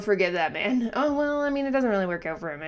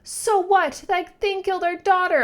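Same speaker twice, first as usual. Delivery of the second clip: high, loud grieving outburst; angry shout; 12 semitones higher, 6 dB louder than usual. Acted. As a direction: hurl it in furious grief. Grieving parent confronting the hero.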